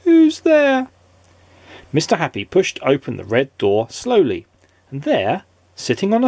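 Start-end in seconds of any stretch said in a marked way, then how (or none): 0.0-0.9 high, squeaky and mournful, louder; the same squeaky sad voice repeated. A narrator's reading. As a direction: call it again, squeaky and glum. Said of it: "A sad, squeaky sort of voice".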